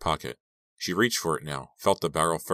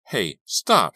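louder, higher plea; urgent, distressed protest; pleading; 4 semitones higher, 7 dB louder than usual. Acted. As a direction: plead with them to stop. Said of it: "she pleaded".

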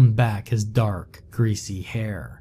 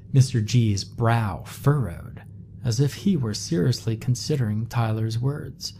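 ordinary delivery

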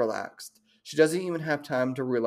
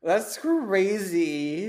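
drawn-out mock awe; 5 semitones higher, much slower than usual. Real, not acted.

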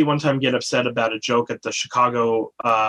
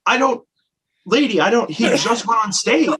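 high, exasperated, at a loss; exasperated and lost for words, pitched up; 9 semitones higher, 3 dB louder than usual. Retold or imitated. Telling off the puppet lady, exasperated and high.

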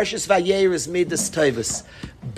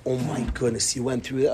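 low, soft empathy; hushed, understanding parent; healthier-paced mirroring; 7 semitones lower, 5 dB quieter, faster than usual. Shown as a demonstration. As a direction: take in the hurt quietly, pitch dropped. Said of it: "mirror his feelings at a healthier pace".

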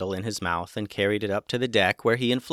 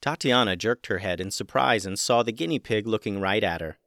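ordinary delivery, nothing marked

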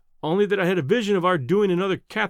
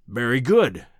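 lower, a touch louder, indulgent concession; 5 semitones lower, 4 dB louder, slower than usual. A narrator's reading. Professor indulges the narrator's doubts, lower and indulgent. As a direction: grant the point like a patient elder.

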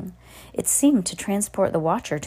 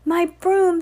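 high, loud wail of dismay; crying out; 12 semitones higher, 6 dB louder than usual. A narrator's reading. Sarah finding her broom gone, high cry. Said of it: "Sarah cried".

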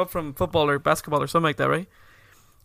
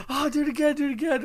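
put-on high-pitched kid wanting more; mock eager begging; 7 semitones higher, faster than usual. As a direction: plead excitedly like a thrilled kid. Retold kids begging for more.

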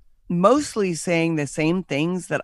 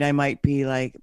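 ordinary talk, nothing marked

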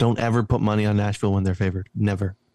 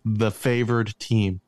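slowed right down to make the point; slow, emphatic insistence; much slower than usual. Real, not acted. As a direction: lay it out word by word, firmly. Insisting on his pick.

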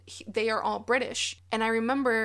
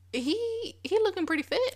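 much higher, admiring; excited praise; 9 semitones higher than usual. Real, not acted. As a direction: gush about how fit he looks. Admiring older actor.

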